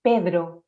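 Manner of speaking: "Pedro" with a D before a tapped R; DR replacing trill. Shown as a demonstration. Speech therapist shows children's next step, DR for RR. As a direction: say "Pedro" as an attempt at "perro", D plus R. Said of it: "sería intentar decir".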